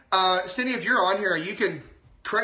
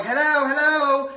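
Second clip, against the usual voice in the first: put-on baby calling out; 6 semitones higher, 5 dB louder, slower than usual. Baby testing if someone comes.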